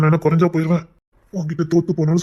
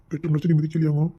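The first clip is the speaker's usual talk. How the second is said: normal talking voice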